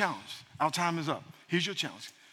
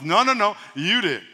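ordinary delivery

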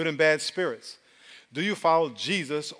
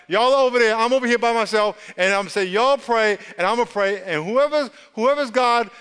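higher, louder, driving preacher voice; preaching at full force; 4 semitones higher, 3 dB louder than usual. Acted. Preacher rousing the congregation, louder and higher. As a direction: preach it hard to the room.

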